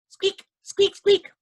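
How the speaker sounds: high tiny squeaks; mouse squeak. Acted. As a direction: squeak like little mice. Mice squeaking.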